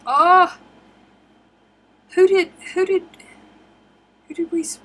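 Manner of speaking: loud, high surprised Oh!; sudden delighted recognition. Real, not acted.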